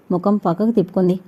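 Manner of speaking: ordinary delivery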